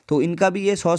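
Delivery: ordinary talk, nothing marked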